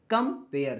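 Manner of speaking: "Compare" in two separate, falling beats. Shown as a demonstration. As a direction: break "compare" into कम and pair. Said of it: "कितने झटके में बोल रहे हैं".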